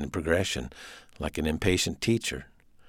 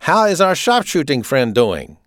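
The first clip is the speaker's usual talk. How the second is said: higher, authoritarian German officer; staccato German accent; 8 semitones higher, 6 dB louder than usual. A narrator's reading. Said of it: "he spoke with a staccato German accent".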